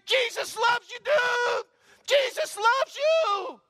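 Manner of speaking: high, loud, stretched shout down the street; far-carrying joyful shout; shouting while running. Retold or imitated. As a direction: yell it after him as you run. Friend running after stabbing.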